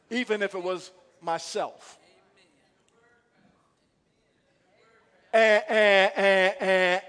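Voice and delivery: loud, driving preacher cadence; preaching at full force. Acted. Pastor urging the congregation, loud preaching. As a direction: pound the point home to the church.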